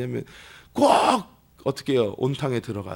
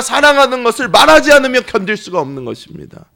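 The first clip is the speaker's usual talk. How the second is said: louder, building passionate sermon voice; full-force pulpit crescendo; fervent preaching; 6 semitones higher, 10 dB louder than usual. Acted. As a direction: preach it with rising fire. Preaching climax.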